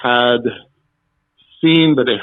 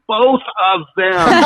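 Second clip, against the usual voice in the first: high, slow, insistent urging with a laugh; emphatic coaching; 9 semitones higher, slower than usual. Retold or imitated. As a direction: nod hard and push the answer across.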